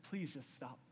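low, soft, sorrowful begging; hushed, heartbroken appeal; quiet plea. Acted. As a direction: plead softly, worn down by grief. Heartbroken king pleads with thief.

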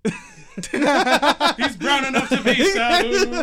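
loud high announcer shout, laughing; wrestling ring announcer. Acted. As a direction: boom it out to the arena. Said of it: "wrestling promo".

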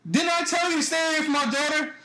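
high-pitched mock scolding dad; retold angry confrontation. Girlfriend's dad warning off a boy, mock angry. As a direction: confront him like an angry father, imitated.

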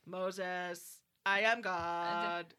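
slow, droning robot deity; loud, measured robot proclamation; robot voice of God. Acted. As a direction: intone each word like a machine god. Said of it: "it's like a robot voice".

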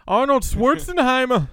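loud, high mock Austrian accent; hammed-up Austrian accent; Arnold Schwarzenegger. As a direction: shout the name like an Austrian action star. More attempts at the Arnold voice.

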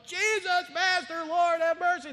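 high, loud pleading call; distant shouting. Retold or imitated. Lepers shouting to Jesus from far off, pleading. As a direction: shout across three hundred yards. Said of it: "They're probably just shouting".

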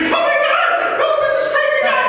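very high-pitched mock alarm; panicked onlookers. Onlookers shielding kids from a yelling man, mock panic. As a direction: squeal it like frightened bystanders, hammed-up.

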